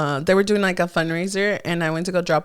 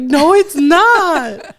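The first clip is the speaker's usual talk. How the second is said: wailing high and drawn out in mock heartbreak; mock crying; 9 semitones higher, 6 dB louder, much slower than usual. Mom wailing at her son over his girlfriend, mock crying. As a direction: stretch it into a long mock sob.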